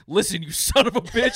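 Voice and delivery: loud, high retort through laughter; mock angry yell. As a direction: act out a candidate snapping at a heckler. Acts Biden yelling at worker.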